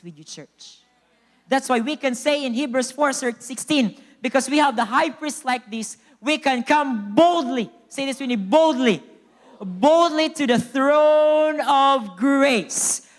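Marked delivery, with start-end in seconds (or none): 1.5-13.1 loud, high preaching that slows to a stretched finish; climactic preaching. Real, not acted. Preacher has church say "boldly", preaching to a climax. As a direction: build to "boldly", then draw out the last phrase.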